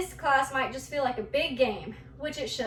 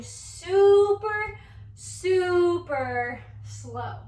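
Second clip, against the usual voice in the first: drawn-out slow words, rising on the first super; slow, stretched teacher demo; 4 semitones higher, 3 dB louder, much slower than usual. Shown as a demonstration. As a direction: stretch each word out like a sloth. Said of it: "I want you to move as slow as you can".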